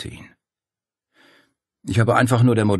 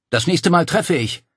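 higher, louder shout over the din; bellowed warning; 10 semitones higher, 5 dB louder than usual. A narrator's reading. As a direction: roar it to stop the men in their tracks. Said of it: "sie brüllte".

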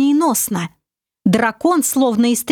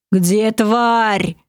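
rumbling dragon. A narrator's reading. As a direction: rumble it like a huge beast. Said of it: "пророкотал дракон".

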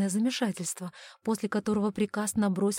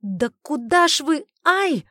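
high, loud exclamation ending in a yelp; indignant cry breaking into "ow"; startled protest; 7 semitones higher, 8 dB louder, slower than usual. Acted. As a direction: protest loudly, then yelp in pain. Man struck during the chase.

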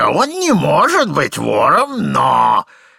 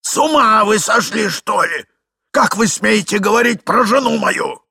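put-on higher-pitched indignant old husband; outraged shout; 5 semitones higher, 3 dB louder than usual. A narrator's reading. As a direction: berate the man for insulting your wife. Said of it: "закричал старик". (возмущённый крик старика)

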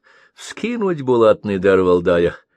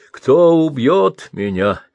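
"Кто" drawn out, higher and louder, slowly; pleading to the sky; 5 semitones higher, 6 dB louder, slower than usual. Acted. Old man begging the heavens for death, slow loud plea. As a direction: beg the heavens with a slow rising cry. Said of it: "взмолился в небо".

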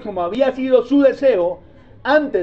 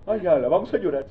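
softer, quick, prim little cry; lightly mocking cachaco mourner; mock reserved mourner; 7 semitones lower, 7 dB quieter, faster than usual. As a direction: play a restrained highlander at a wake. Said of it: "Aquí todos son más cachacos".

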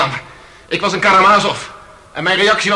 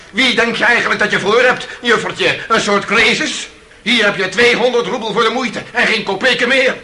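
contemptuous sneer; 7 semitones higher than usual. Retold or imitated. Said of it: "Ik had willen zeggen".

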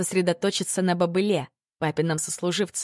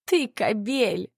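sobbing; 4 semitones higher, much slower than usual. A narrator's reading. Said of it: "всхлипнула мама".

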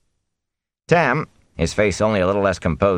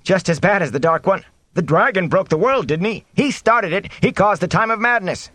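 agitated, high-pitched crowd cries; villagers shouting; 11 semitones higher than usual. A narrator's reading. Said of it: "men and women shouting over one another".

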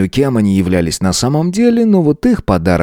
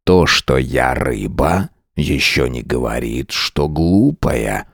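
whispery telepathic voice; 7 semitones lower, slower than usual. A narrator's reading. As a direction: speak as a fish inside his mind. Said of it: "Чужой мужской голос прозвучал прямо в голове".

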